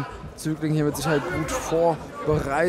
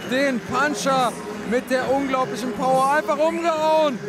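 excited commentary; 7 semitones higher than usual. Real, not acted. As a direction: relive the knockout with delight. Co-commentator celebrates the knockout.

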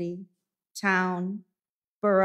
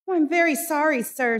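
higher, flustered clerk's voice; timid apology; 7 semitones higher, 4 dB louder, faster than usual. Acted. Clerk Bob apologizes to his stern master, higher and flustered. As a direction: apologize fast before he bites.

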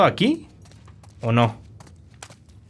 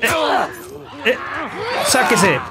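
high, urgent warning call; 9 semitones higher than usual. Real, not acted. Gamer warning as a clicker approaches, urgent and high. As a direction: warn fast, tense.

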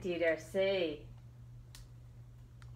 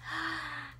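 gasp of discovery; 9 semitones higher than usual. Acted. Anteater discovers the answer.

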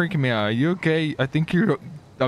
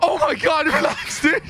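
excited shout; 13 semitones higher than usual. Real, not acted. Gamer laughing as his friend gets mad, high excited shout. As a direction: blurt it out in delight.

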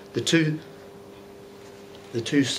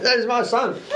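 passing pilot; 11 semitones higher than usual. Retold or imitated. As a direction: shout it down like a pilot passing overhead. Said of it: "a pilot walking past going".

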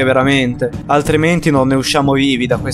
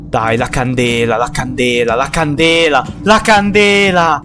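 frustration building; 4 semitones lower than usual. Real, not acted.

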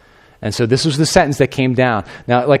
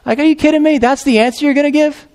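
incredulous scoff; 13 semitones higher than usual. Acted. Pilate scoffing at the accusers' lame excuse, very high. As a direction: scoff at a ridiculous answer.

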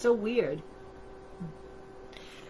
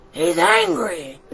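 higher, slower, drawn-out anger; mock angry; 7 semitones higher, 6 dB louder, slower than usual. A narrator's reading. Reader repeating the monster's anger to children, mock angry. Same words repeated with more force.

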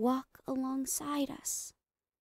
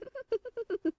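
unhinged high giggle; 12 semitones higher than usual. Acted. Giggle after whispered confession.

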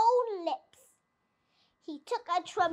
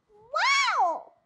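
high, amazed gasp of a small fox; gasping wow; 9 semitones higher, 5 dB louder than usual. A narrator's reading. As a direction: gasp it out, dazzled by the first sip. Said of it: "he gasped".

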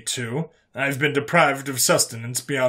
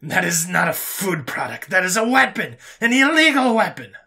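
indignant accusation; 7 semitones higher, 5 dB louder than usual. Acted. Alien prisoner calling the food a weapon, indignant. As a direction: build to a high, loud accusation.

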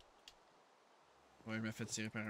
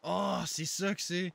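startled exclaim; 7 semitones higher, 8 dB louder, slower than usual. Real, not acted. Startled realization in game.

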